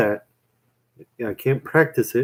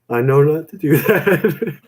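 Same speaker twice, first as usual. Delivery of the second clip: slow, higher, dissolving into laughter; laughing; 5 semitones higher, 4 dB louder than usual. Real, not acted. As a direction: try to finish while cracking up. Cracks up at own bad joke.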